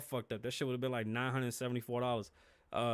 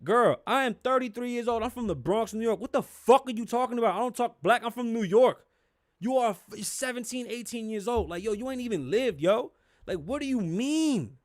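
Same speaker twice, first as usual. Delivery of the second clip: loud, higher incredulous telling-off; retold angry comeback; 8 semitones higher, 6 dB louder than usual. Replaying his reply to a girl at 7-Eleven, loud indignant retort. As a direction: fire it back at her, offended.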